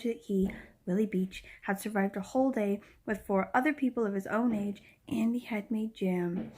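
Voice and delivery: a storyteller reading to her viewers, whispering the last line; a fond book reading; reading aloud. Acted. Reads a passage from the novel.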